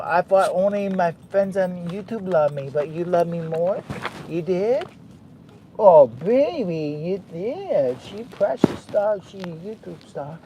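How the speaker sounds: high, sing-song cooing to a pet. Real, not acted. Doting owner cooing to his wet dog. Baby talk to dog throughout.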